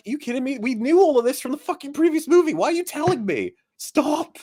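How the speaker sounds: exasperated disbelief. Retold or imitated. Viewer scolding the movie for repeating itself, high exasperation. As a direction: plead with the film to stop repeating itself. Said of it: "I was like".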